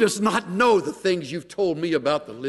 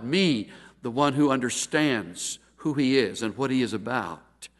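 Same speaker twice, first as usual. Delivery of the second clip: normal talking voice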